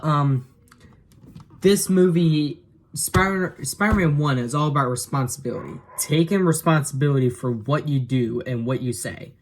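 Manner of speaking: ordinary talk, nothing marked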